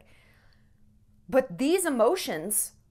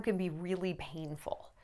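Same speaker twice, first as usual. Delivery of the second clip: barely voiced whisper, much softer; 5 semitones lower, 11 dB quieter than usual. Real, not acted. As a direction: let it fall to a whisper. Voice drops to whisper.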